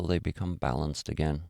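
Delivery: soft, even voice with long pauses; guided meditation. Real, not acted.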